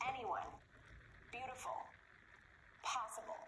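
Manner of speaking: AN-yone, BEAU-tiful, POS-sible; first-syllable stress. Shown as a demonstration. Teacher showing three-syllable words, first syllable stressed in anyone, beautiful, possible. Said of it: "It can be at the beginning".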